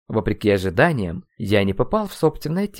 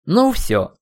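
high, loud exasperated burst; losing temper; 9 semitones higher, 5 dB louder than usual. A narrator's reading. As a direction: snap as patience runs out. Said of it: "окончательно вышел я из себя". (выходя из себя)

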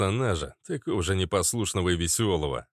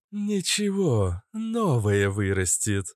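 slow, drawn-out dismissal; unconcerned taunt; 3 semitones higher, slower than usual. Acted. Cobra holding the ear shrugs off pain, drawling. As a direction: stretch it out, high then dropping.

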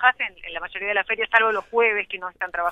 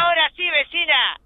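hard sell ad; 6 semitones higher than usual. Retold or imitated. Reporter mimics market vendor cries.

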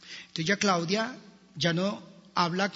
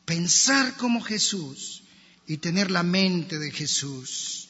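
ordinary talk

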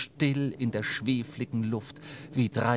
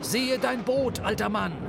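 screamed warning; 9 semitones higher, 3 dB louder than usual. A narrator's reading. Starbuck to the old man about his boat, alarmed shout. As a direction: call urgently to make him look. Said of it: "schrie Starbuck".

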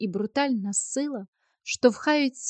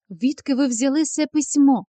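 louder, abrupt questioning; sharp demand; 3 semitones higher, 8 dB louder than usual. A narrator's reading. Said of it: "сказав він різко". (різко, голосно й вимогливо)

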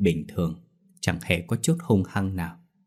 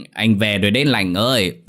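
put-on louder drunken slurred calling; 3 dB louder than usual. A narrator's reading. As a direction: drag out Lành ơi drunkenly. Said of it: "giọng lè nhè say mềm".

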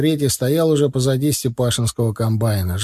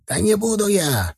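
high, indignant refusal; mildly angry yell; 9 semitones higher than usual. A narrator's reading. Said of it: "заорал Степашин злобно". (сдержанно-злобный выкрик, высоким голосом)

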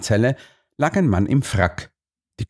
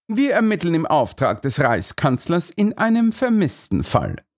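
higher, official detective tone; Holmes character voice; 8 semitones higher than usual. Acted. Holmes tells the guard about the chancellor, important tone. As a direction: announce the mission with importance.

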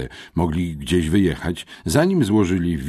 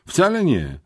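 denial; 14 semitones higher, 6 dB louder than usual. A narrator's reading. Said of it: "zaprzeczył mały Bartoś".

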